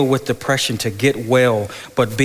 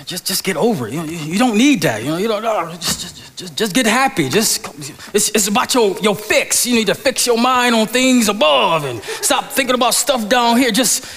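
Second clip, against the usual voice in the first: imitating a dismissive husband, much higher and louder; insensitive husband; 10 semitones higher, 3 dB louder than usual. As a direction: brush her off with preachy quick fixes. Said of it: "I just grow insensitive to".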